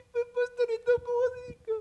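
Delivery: high, sobbing, hammed-up crying voice; mock crying sister. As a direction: whimper it like a crying old woman. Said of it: "parang nanay ko eh". (pabirong umiiyak na boses ng matandang babae)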